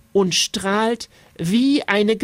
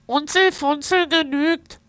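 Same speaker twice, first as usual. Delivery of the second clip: hohe Nasenstimme des Wesens; 8 semitones higher than usual. A narrator's reading. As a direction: sprich als freundliches Fabelwesen. Wesen spricht mit Nasenstimme.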